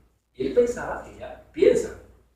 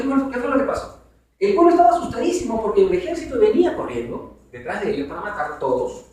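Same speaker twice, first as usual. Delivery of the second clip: ordinary talk, nothing marked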